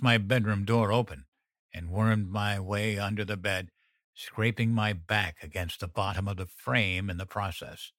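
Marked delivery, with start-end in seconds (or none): none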